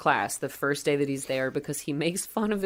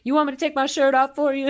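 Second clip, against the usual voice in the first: high, quick, loud come-on; Juanita; 11 semitones higher, 6 dB louder, faster than usual. Acted. Housekeeper offering comfort to Billy, high eager voice. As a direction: pitch it way up and rush the offer. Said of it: "And she's like".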